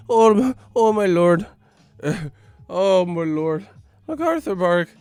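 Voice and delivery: high-pitched mock Irish exclaiming; Irish accent. Comedian doing Conor McGregor act, high mock Irish accent. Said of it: "had me with an Irish accent".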